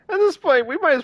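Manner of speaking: a sudden mock-squeaky "At this point"; high comic squeak on "this", falling at the end. Repeats the phrase, first take squeaked.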